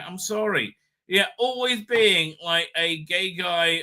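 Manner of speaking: ordinary delivery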